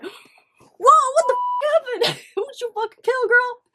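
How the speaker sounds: high, loud, stretched-out shock; shocked reaction. Retold or imitated. Reacting to her friend's arrest news in loud shock. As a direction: scream it in disbelief. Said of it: "When I saw that, I was like".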